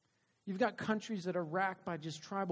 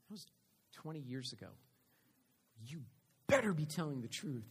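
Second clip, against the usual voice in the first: ordinary talk, nothing marked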